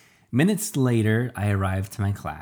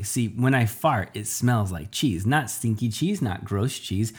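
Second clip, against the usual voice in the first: ordinary delivery, nothing marked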